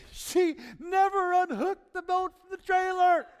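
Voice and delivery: high, loud, slow punchline shout; the diver shouting his discovery, laughing. Retold or imitated. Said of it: "spitting and laughing hilariously".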